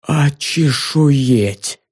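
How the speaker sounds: drawn-out, stunned, very slow; stunned gasp. A narrator's reading. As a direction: squeeze the word out in shock. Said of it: "выдавил Филин с потрясённым видом".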